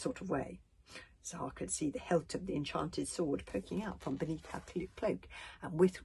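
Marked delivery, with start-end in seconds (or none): none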